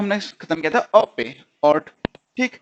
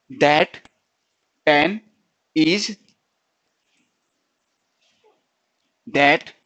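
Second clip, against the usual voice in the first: each word said alone with long pauses; slow dictation; 2 semitones lower, much slower than usual. Shown as a demonstration. Teacher dictating the vowel formula slowly. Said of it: "short vowels का जो formula था".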